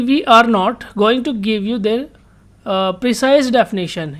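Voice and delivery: reads the English line very slowly, word by word; slow reading aloud. Shown as a demonstration. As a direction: spell out the instruction slowly for students. Teacher reads textbook instruction aloud. (धीरे-धीरे पढ़कर सुनाना)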